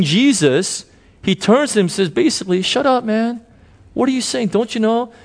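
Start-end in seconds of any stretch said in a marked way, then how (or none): 2.6-5.1 high-pitched mock telling-off; indignant retort. Thief tells the other criminal to stop cursing, indignant retort. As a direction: act out an exasperated comeback, voice pitched way up.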